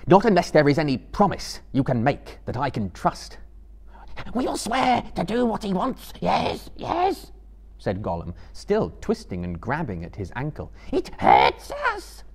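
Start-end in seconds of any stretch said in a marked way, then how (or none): 0.1-3.4 raised, emphatic voice; firm demand. A narrator's reading. Frodo demands a promise from Gollum, firmly. 4.3-7.3 very high, desperate creature squeal; Gollum. A narrator's reading. Gollum twisting at his ankle promises, desperate. 10.9-12.2 very high, loud, drawn-out wail; pained creature shriek; Gollum. A narrator's reading. Gollum grabbing at his tied ankle, wailing.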